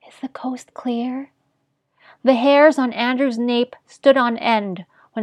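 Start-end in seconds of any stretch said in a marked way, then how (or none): 0.1-1.3 hushed, timid half-whisper; timid, barely voiced question. A narrator's reading. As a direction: ask it very softly, as if afraid to be overheard. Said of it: "in a timid voice".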